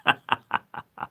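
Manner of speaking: voiceless chuckle in short bursts; laugh. Real, not acted. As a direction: break into a breathy chuckle. Laughing at own speech.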